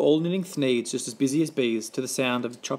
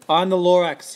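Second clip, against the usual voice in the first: louder, raised declaration; coughing Lorax; 4 semitones higher, 6 dB louder than usual. A narrator's reading. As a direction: announce yourself loudly. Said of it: "he coughed and he whiffed".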